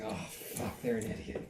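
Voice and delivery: quiet, nearly whispered dismissal; muttered dismissive aside. Retold or imitated. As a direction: say it under your breath, writing them off. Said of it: "I'm just like".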